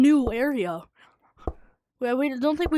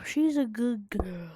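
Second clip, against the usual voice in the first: whispering; 5 semitones lower, 9 dB quieter than usual. Real, not acted. Whispered line.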